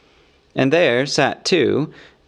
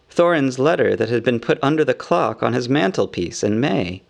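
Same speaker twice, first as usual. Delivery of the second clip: usual speaking voice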